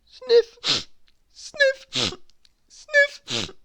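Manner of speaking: high-pitched voiced sniffs; very high imitation of sniffling; sniffing noise. A narrator's reading. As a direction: squeak the word like a sharp sniff. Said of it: "a sort of sniffing noise".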